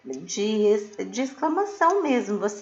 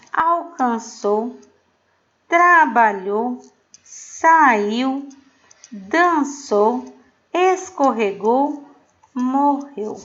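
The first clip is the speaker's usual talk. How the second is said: slow dictation; 2 semitones higher, 5 dB louder, much slower than usual. Shown as a demonstration. Teacher dictating past-tense verbs.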